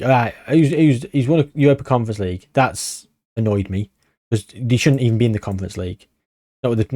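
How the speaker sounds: usual speaking voice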